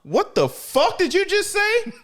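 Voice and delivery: high-pitched incredulous outburst; mock outrage. Retelling a character's shock at the news, mock outrage. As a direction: squeal it in comic disbelief.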